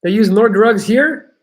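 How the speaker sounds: high, loud and slow disbelief; can't believe what you just read; incredulous. Real, not acted. Incredulous echo of chat.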